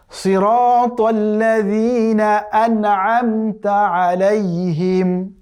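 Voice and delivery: drawn-out tajweed chanting; slow Quran recitation. Shown as a demonstration. Teacher reciting the verse for students, slow chant. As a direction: recite each word slowly and carefully. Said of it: "total আয়াতটা কি দাঁড়ালো".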